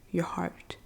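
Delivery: breathy whispered line. Retold or imitated. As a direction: whisper it like a secret come-on. Monica's flirty line quoted.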